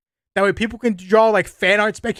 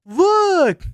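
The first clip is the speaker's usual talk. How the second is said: excited; 12 semitones higher, 6 dB louder than usual. Real, not acted. Excitedly showing something on screen.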